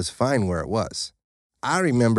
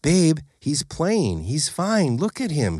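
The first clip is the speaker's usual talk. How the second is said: slow, higher calming; mild reassurance; reassuring; 6 semitones higher, slower than usual. A narrator's reading. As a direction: soothe the worried husband. Wife calms husband.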